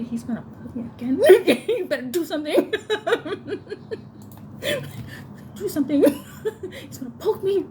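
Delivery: high, laughing through the words; laughing panic. Retold or imitated. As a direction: plead to husband while cracking up. Panicked plea to husband.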